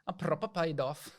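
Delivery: put-on Love Island British accent, lightly done; British Love Island lad accent; mock British accent. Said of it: "the Love Island one?".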